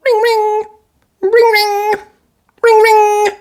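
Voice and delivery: very high ring ring; ringing telephone voice; phone ring. A narrator's reading. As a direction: imitate a phone.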